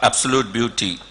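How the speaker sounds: ordinary delivery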